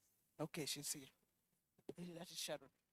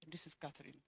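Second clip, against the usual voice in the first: whisper; 10 semitones lower, 21 dB quieter, faster than usual. Real, not acted. Aside while names are called.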